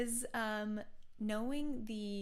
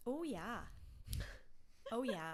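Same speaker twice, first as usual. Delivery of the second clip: mock Canadian oh yeah; 3 semitones higher than usual. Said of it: "very Canadian".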